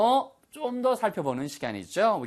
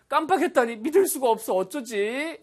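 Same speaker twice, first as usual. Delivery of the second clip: high-pitched, lightly acted dismay; dismayed disbelief; 10 semitones higher than usual. Teacher prompting students with a forgotten task, dismayed disbelief. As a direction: play at being startled by forgetting.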